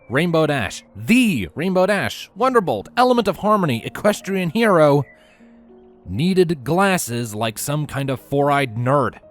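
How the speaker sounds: ordinary delivery, nothing marked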